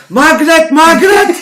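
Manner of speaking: high, loud mock scream of a name. Grandma screams for her daughter in a mock panic. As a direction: shriek the name like a startled gran. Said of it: "she's screaming".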